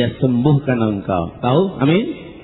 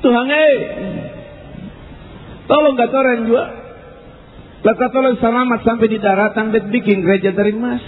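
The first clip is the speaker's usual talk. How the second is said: higher-pitched mock desperate prayer; mock pleading; 9 semitones higher than usual. Man in a storm-tossed boat bargains with God, mock pleading.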